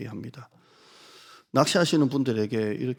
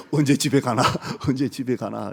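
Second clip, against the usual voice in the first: seasick moaning; 8 dB louder than usual. Retold or imitated. Seasick women moaning on boat.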